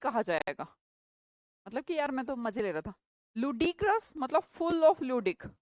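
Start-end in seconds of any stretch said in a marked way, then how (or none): 3.4-5.5 very slow, spelling out full of ludic; slow explanation. Shown as a demonstration. Teacher slowly breaking down Ludicrous for the class. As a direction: lay out each word slowly. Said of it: "teacher spells out the word".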